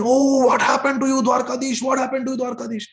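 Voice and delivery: high, loud shocked cry, fading at the end; screaming in alarm. Acted. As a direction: cry out in horror at the bleeding god. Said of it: "They start screaming".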